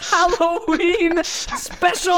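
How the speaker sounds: high, laughing spooky announcer; spooky host voice. Acted. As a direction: draw it out like a haunted host.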